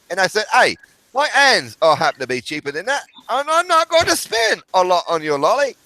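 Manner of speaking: very high, squawky mock British voice; British airmen impression. Shown as a demonstration. Man telling a street-corner tale as British airmen, high silly British accent. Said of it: "from the two British airmen".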